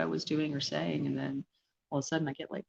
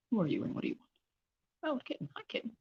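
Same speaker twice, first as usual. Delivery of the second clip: high, soft cooing to kitten; cooing to a cat; 5 semitones higher, 6 dB quieter, much faster than usual. Real, not acted. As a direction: coo at the kitten softly.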